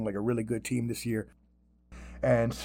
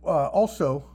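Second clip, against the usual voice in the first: ordinary delivery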